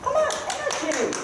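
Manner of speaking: high, pushing call for energy; urging call. Real, not acted. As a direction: urge the rider on with a high call. Instructor urging more energy.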